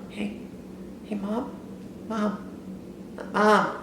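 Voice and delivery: hushed, timid calling; nervous hushed calls to mom; creeping whisper. Retold or imitated. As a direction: sneak up and call her softly. Sneaking up on mom quietly.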